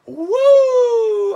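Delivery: long, very high, loud wrestler's whoop; Ric Flair woo. Pretend. Wrestling fan shows off a Ric Flair figure, screaming woo. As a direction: let out a drawn-out screaming woo. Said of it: "I don't wanna scream into the camera".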